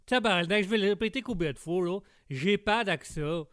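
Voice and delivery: put-on indignant, broad Québécois accent. Québécois guest denies having an accent, indignant thick Québécois accent. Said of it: "ça annonce votre accent".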